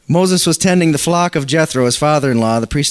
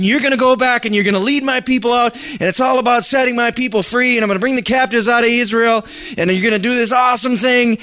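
higher, proclaiming, a bit grand; booming God; 7 semitones higher than usual. Acted. God commissioning Moses, lightly voiced grand proclamation. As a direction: announce it like a divine call.

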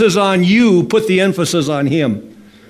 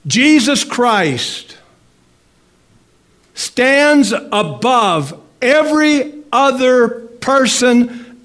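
emphatic preaching; 4 semitones higher than usual. Real, not acted. Pastor telling the congregation Jesus stands alone, emphatic preaching. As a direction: preach it firmly, word by measured word.

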